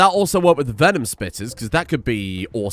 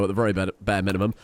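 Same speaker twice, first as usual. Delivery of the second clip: normal talking voice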